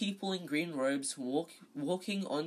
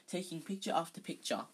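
usual speaking voice

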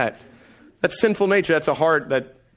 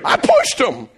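much higher, louder, whiny sob-talk; mock crying kid; 9 semitones higher, 4 dB louder, slower than usual. Son tearfully complaining to dad, high and crying. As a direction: wail like a wronged child. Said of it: "Judah starts crying".

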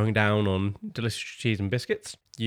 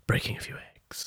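unvoiced ominous whisper; 9 dB quieter than usual. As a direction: breathe it out in a whisper. GM whispers the punchline.

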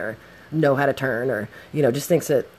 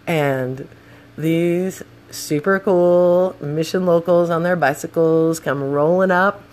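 ordinary delivery

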